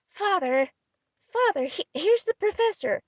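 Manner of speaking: rising, loud scandalized call; scandalized cry. Acted. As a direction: cry out, shocked. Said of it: "Joe cried out with scandalized face".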